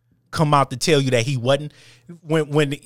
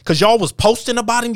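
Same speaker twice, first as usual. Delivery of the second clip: normal talking voice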